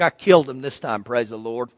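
a sly private mumble about the dead roses; a hint of a guilty self-mutter; muttered aside. Preacher voices his own gleeful thought.

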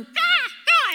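loud, high-pitched mock bird caw; bird squawk. Bird startling a couple walking their dog, imitated squawk. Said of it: "a bird will start going".

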